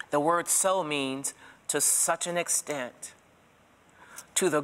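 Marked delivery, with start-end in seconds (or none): 0.1-3.1 "so" held and stressed, words spaced out slowly; slow, deliberate definition. Shown as a demonstration. Teacher tells the church what "so" means, slowly. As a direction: lay out the meaning of "so" slowly. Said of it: "Are you ready?".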